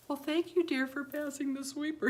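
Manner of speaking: high, sweet thanks to a husband; complimenting wife. Retold or imitated. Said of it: "guys really like to be complimented".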